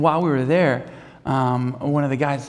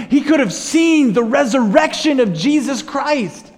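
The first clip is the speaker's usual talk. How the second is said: preaching at full force; 8 semitones higher, 4 dB louder than usual. Acted. Pastor urges congregation to surrender, full-force preaching. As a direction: preach it out, every phrase hammered home.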